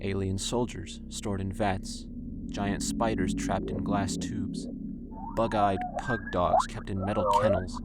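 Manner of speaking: normal talking voice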